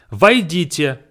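loud doctor calling patients in. Retold or imitated. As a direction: call войдите out like a doctor. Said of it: "the doctor just kinda shouts".

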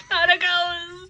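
high, loud, drawn-out mock wail; hammed-up cry of horror at a ghost; mock scream. Joking about the ghost scene, high mock scream. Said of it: "he just starts screaming".

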